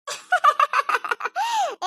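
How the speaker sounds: high burst of mocking laughter. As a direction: laugh at the mother-in-law's jab. Daughter-in-law laughs at taunt. (ताना मारती हँसी)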